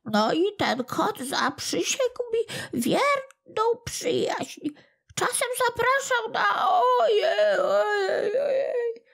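very high, tearful whine of a tiny creature; a sore little imp wailing for pity; whimpering little goblin. Acted. Little kłobuk crying to old Smętek, very high and tearful.

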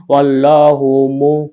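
ওয়াল্লাহু with a full, heavy ল্লা drawn slowly; heavy Allah. Shown as a demonstration. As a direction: read ওয়াল্লাহু thick and slow. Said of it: "আল্লাহ শব্দকে মোটা করে পড়তে হবে।". (মোটা করে ওয়াল্লাহু)

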